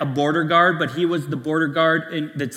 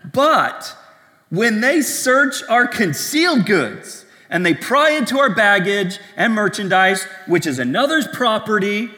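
dramatic read quote; 6 semitones higher, 6 dB louder than usual. Retold or imitated. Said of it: "He says".

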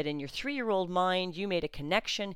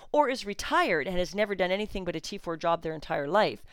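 usual speaking voice

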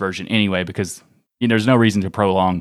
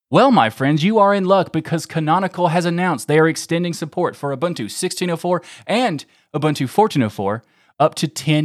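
bright pitchman voice, pitched up; infomercial host; 4 semitones higher than usual. Pretend. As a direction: sell it like a late-night TV ad. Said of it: "I'd be so good at infomercial hosting".

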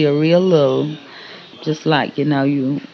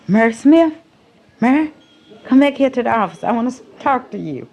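high-pitched, raised call; imitated calling out; 10 semitones higher, 4 dB louder, faster than usual. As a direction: call her over, imitating a boss. Retold boss calling a worker over.

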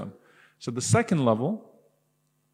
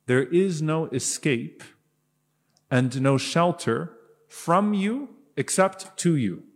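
slow reverent translation; 4 semitones higher, slower than usual. Real, not acted. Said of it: "So beautiful".

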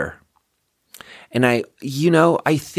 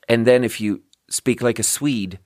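normal talking voice

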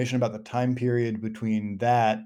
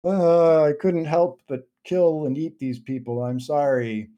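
lightly acted wail of apology, higher pitched; apologetic zombie; 5 semitones higher, 4 dB louder than usual. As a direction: moan an excuse as a mock-remorseful zombie. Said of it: "then he was like".

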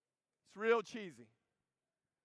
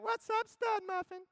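put-on girly voice; 12 semitones higher than usual. Nala cooing back at Simba in a high girly voice. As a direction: coo it like a smitten girl cub.

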